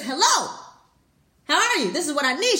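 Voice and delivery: loud, high mock demand; mock demanding boy. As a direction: pipe up loudly as a pushy boy. Impatient boy cuts in, imagined.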